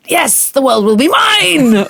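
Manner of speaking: slow, high evil villain boast; mad scientist. Pretend. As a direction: gloat like a cartoon villain. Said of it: "evil genius kind of thing".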